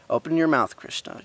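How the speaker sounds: firm order, high and loud at first; a mother's stern order. A narrator's reading. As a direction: command the child, dropping on the name. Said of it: "Yashoda said sternly".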